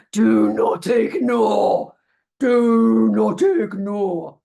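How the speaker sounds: slow, loud ominous reading; menacing warning. Retold or imitated. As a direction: intone the envelope like a threat.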